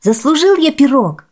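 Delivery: put-on high, loud boyish claim; eager little boy's shout; boy crying out. A narrator's reading. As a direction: call out for your reward. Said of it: "закричал Хуанита".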